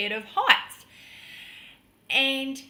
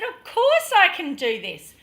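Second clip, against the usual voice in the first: loud, higher show of confidence; brave front; 5 semitones higher, 6 dB louder than usual. Retold or imitated. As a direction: put on a brave, breezy certainty. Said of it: "I had that brave sort of exterior".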